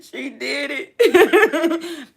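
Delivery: high, slow, half-laughing mock sob; "did it" stretched high, laughing through mock tears; retold crying. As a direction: replay the happy tears, drawn out and high. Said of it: "I'm sitting there crying like".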